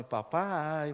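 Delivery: usual speaking voice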